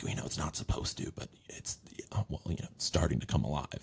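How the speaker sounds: whispered, hushed and conspiratorial; secret whisper. Retold or imitated. AI confiding to the actor in a hushed whisper.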